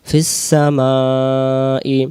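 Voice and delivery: ফিস সামাই with the s joined, very slow; joined fis-samai. Shown as a demonstration. Teacher joins the letters for students, very slow. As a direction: glide ফিস into সামাই, drawn out. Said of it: "সিন অক্ষরের সাথে ফা অক্ষর মিলবে".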